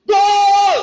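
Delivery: loud commentator yell; shouted goal. Pretend. Teacher acting out a goal, loud shout. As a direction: belt out gol as the ball goes in.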